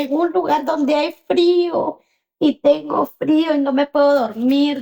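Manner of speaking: high-pitched plaintive complaint; tearful child. Retold or imitated. Cold, sleepless daughter to her mother by phone, plaintive. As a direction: imitate a miserable child pleading for comfort. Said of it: "porque su hija está llorando".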